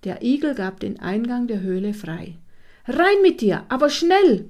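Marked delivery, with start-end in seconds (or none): 2.9-4.4 loud, high, brisk-sounding order; commanding. A narrator's reading. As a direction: order him inside with raised voice. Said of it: "befahl er". (laut und energisch befohlen)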